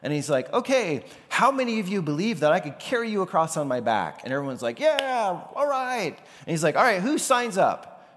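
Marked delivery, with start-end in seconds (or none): 0.5-4.2 high, loud showman's call; calling out to the crowd. Retold or imitated. Blondin calling out to the crowd of onlookers, high and loud. 4.8-6.2 high, hammed-up reluctant yeah; mock crowd reply. Crowd answering Blondin, high mock reluctant agreement. 6.8-8.1 high, loud showman's challenge; calling for volunteers. Retold or imitated. Blondin challenging the crowd to volunteer, loud and high.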